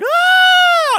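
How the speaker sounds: very high, loud mock scream; piercing comic screech; screeching little girl yell. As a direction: imitate a big man shrieking like a little girl. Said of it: "with this screeching little girl yell".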